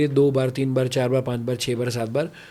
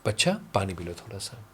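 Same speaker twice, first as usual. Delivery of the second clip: softer, tender offer of water; gentle parent; 5 semitones lower, 8 dB quieter than usual. Retold or imitated. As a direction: ease the child down quietly. (धीमी, कोमल आवाज़ में)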